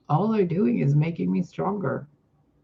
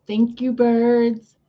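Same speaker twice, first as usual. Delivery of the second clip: sarcastic; 6 semitones higher, 6 dB louder, much slower than usual. Real, not acted. Sarcastic thanks to birds.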